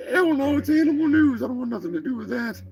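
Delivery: high, hurried turkey-robot voice; huffy Turkinator turkey. Acted. Turkey announces its exit to the host, high and huffy. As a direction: storm off in a high cartoon voice. Plays the Turkinator refusing animal news.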